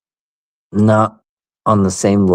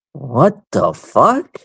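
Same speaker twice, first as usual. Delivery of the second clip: mock disbelief; 5 semitones higher, much slower than usual. Seeing teachers in bar.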